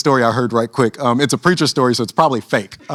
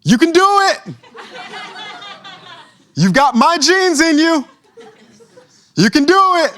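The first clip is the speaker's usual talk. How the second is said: high, loud encouraging shout from the chair; cheering dad; 3 dB louder than usual. Acted. Father calling encouragement to his stuck son, high and loud. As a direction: cheer the kid on from a distance. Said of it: "he says, he says".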